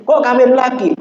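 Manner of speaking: high-pitched mock astonishment; comically taken aback; surprised questioning. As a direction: retell it as an incredulous question, pitch jumping up. Retold incredulous questioning of the imam.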